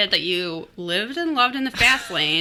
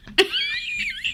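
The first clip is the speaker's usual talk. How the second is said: shrieking laugh. Real, not acted. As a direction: explode with laughter. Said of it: "I'm laughing so hard".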